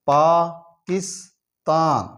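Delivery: Pakistan stretched slowly, each syllable drawn out; slow syllable drill. Shown as a demonstration. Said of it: "syllable drilling". (Pakistan को बहुत धीरे खींचकर बोलना)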